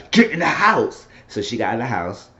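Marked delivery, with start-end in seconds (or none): 0.1-1.1 mock order. [name] repeating his order.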